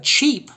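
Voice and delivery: "cheap" high and loud with long ee. Shown as a demonstration. Teacher contrasting it with chips, long E sound. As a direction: punch and stretch the ee in "cheap". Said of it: "has a long E sound".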